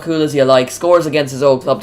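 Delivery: ordinary talk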